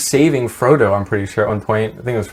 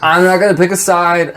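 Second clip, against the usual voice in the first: higher, playful imagined Saruman; high mock Saruman; 7 semitones higher, 3 dB louder than usual. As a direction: toss it off high and breezy.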